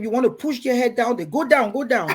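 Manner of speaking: normal talking voice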